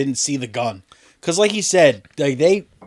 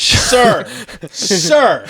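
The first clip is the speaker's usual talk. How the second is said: loud scolding; 6 semitones higher, 4 dB louder than usual. Pretend. Acted MRI tech scolding.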